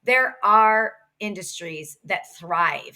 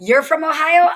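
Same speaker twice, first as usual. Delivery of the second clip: bright friendly; 8 semitones higher, 9 dB louder than usual. Retold or imitated. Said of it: "it started with a".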